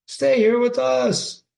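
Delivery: high, slow, sugary mock plea; mock Claudius. Teacher mocking Claudius urging Hamlet to stay, high and slow. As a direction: ham up the king's fake sweetness.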